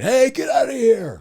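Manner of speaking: high-pitched acted holler; background-character scream. Shown as a demonstration. Voice actor showing a background anime part, screaming. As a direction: scream it like a crowd extra chasing someone off. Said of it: "that's the guy that's screaming".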